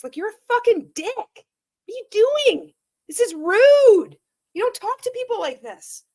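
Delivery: high, indignant scolding; exasperated outburst. Real, not acted. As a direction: tell him off in real disbelief.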